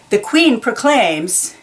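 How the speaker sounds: ordinary delivery, nothing marked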